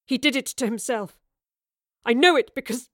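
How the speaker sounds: insistent, mildly tearful, breaking off; insist, then stop short; upset, near choking. A narrator's reading. Young man blaming father for brother's death, near choking. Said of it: "trying not to choke on the words".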